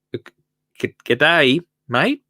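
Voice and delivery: mock Australian G'day, mate; a light Aussie G'day, mate; Australian accent. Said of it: "allowed to do an Australian accent".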